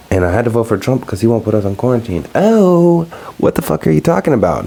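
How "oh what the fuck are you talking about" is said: high-pitched incredulous retort; mock disbelief, jumping up in pitch. Incredulous reply to a voter.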